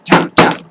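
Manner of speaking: two loud, sharp gavel knocks; imitated gavel taps. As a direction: mimic a banging gavel. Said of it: "It goes".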